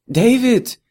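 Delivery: a sudden high, loud cry of alarm; horrified cry. A narrator's reading. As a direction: shout his name in shock as he drops the case. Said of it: "rief Natascha entsetzt".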